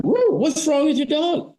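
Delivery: slow, high howl then a puzzled question; howl, then ask the farmer slowly and high; howl then salesman. Retold or imitated. Dog howls, salesman asks again.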